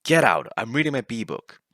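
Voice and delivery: loud, indignant retold yell. Narrator to roommate who opened the door, yelling. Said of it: "then I yelled".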